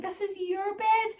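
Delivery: high, slow sing-song mummy voice; sing-song to toddler. Retold or imitated. Mum showing her toddler the new bed, in a high slow coo. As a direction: coo it slowly to a little one.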